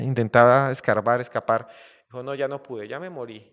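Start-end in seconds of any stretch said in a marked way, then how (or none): 2.2-3.5 defeated lion, softer. Acted. Lion gives up hopelessly.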